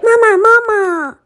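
put-on high, childlike cry for mama; calling out. A narrator's reading. Tadpole chases the turtle, calling out. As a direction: call after someone in a very high voice. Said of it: "就追上去喊".

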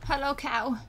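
usual speaking voice

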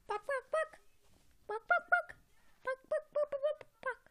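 very high, rapid squeaky clucks; clucking animal. Pretend. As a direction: cluck in threes like a toy bird.

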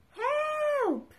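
high mock cry of help. Reader crying help as the pram runs off, high. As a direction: call out in pretend alarm.

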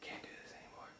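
breathy, barely there whisper; whispered surrender; defeated whisper. Acted. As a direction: whisper it like giving up. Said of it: "He's like".

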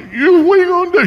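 high mock-panicked question; hammed-up high-pitched bewilderment; alarmed disbelief. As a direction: squeal it like an alarmed kid. Bewildered child questions father's strange purchases.

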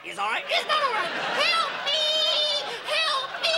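very high, frantic cries for help; panicking humidor. Acted. Humidor cries out for help to the auctioneer, very high and frantic. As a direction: wail for rescue.

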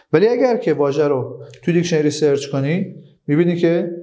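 ordinary delivery

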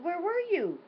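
high, demanding where were you; lightly voiced petulant child calling her mother. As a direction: daughter half-mockingly questioning her mother. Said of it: "I said".

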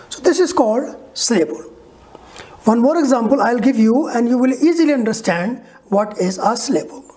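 ordinary talk, nothing marked

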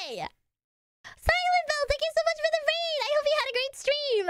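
very high-pitched squealed thanks; gushing thanks. Real, not acted. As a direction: gush at a friend in a high, sing-out voice. Greets a raid with thanks.

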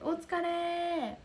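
high, lingering call; drawn-out greeting. Real, not acted. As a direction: stretch the cheer out to a returning viewer. Greets viewer who finished event.

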